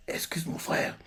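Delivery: unvoiced whisper; whispered apology. Finn whispering an apology for his brother. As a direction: whisper it aside, embarrassed. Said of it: "Finn fait".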